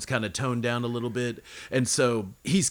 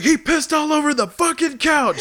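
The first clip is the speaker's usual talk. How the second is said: high, loud outraged yelling; angry shout; 7 dB louder than usual. Retold or imitated. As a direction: rant it in disbelief. Angry friend accusing, retold.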